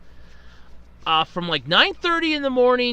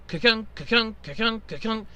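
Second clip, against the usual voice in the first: pump noise imitation; 2 semitones lower, 6 dB quieter than usual. Imitating the pump's clicking noise.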